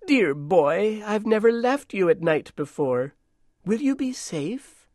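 put-on high, concerned voice to a boy; Dame Petersen. A narrator's reading. Dame leaving the boy at night, high and anxious. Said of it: "Dame Petersen turned to Frederick".

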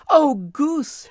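put-on high, loud girlish call. A narrator's reading. Girl calling to the roast goose, high and loud. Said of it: "she called".

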